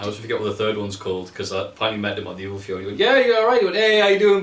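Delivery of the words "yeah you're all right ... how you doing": loud, high, mock-hearty hello; breezy greeting to someone you don't know; generic friendly greeting. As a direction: put on a big all-purpose hello. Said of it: "But, like, generic".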